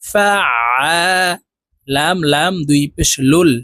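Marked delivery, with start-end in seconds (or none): 0.0-0.8 "ফা আ" with the final vowel stretched; one-alif elongation. Shown as a demonstration. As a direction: hold the "আ" in "ফা আ" long after a mid-throat ain. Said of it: "এক আলিফ টানিয়া পড়িতে হয়".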